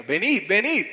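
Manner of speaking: put-on loud, high-pitched Argentine accent; acento argentino. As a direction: call out like an Argentine. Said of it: "con el acento argentino". (acento argentino imitado, fuerte y agudo)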